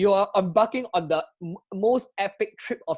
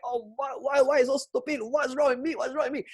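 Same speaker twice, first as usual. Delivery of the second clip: frustrated; 6 semitones higher than usual. Retold or imitated. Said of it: "such frustration, such panic, such anger".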